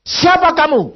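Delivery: loud, high divine challenge; God rebuking, voice like thunder; thundering rebuke. Retold or imitated. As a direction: boom it out like thunder. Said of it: "Tuhan berkata begini".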